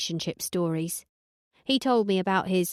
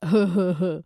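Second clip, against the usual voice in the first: put-on girly giggle; 4 dB louder than usual. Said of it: "giggled the way a Hillary would".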